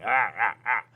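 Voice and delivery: sharp, loud "ah, ah, ah" cut-offs; mock shushing "ah". Dad silencing his son's excuses, mock interrupting "ah" bursts. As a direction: cut someone off with short bursts.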